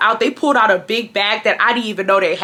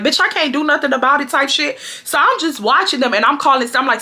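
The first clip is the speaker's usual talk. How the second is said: usual speaking voice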